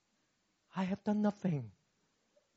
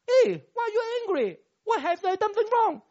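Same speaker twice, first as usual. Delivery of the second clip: high, louder bewildered husband asking; puzzled husband; 14 semitones higher, 5 dB louder than usual. Retold or imitated. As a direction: play the confused husband, pitched high. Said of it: "husband asks wife why angry".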